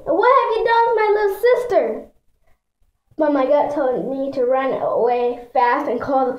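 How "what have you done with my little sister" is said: high, loud outraged demand; screamed. A narrator's reading. Narrator confronting a strange woman in her sister's room, screaming. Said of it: "I wanted to scream".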